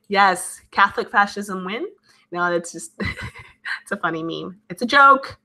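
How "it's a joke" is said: higher, louder protest; insistent. Real, not acted. Disclaiming a funny meme to the chat, insistent. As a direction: call it out as a playful disclaimer.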